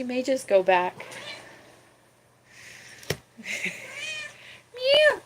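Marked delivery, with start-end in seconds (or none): 4.7-5.2 high meow; mock meow. Real, not acted. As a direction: meow at the kitten. Meowing at the cat.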